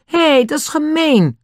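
high, indignant shout of protest. Acted. Nobby shouting at the chimp who stole the ball, high indignant yell. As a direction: yell it out, cheated. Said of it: "schreeuwde Nobby".

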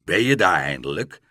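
loud call from the doorway. A narrator's reading. Father calls to his lost son, loud. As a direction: call out, relieved at last. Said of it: "riep hij".

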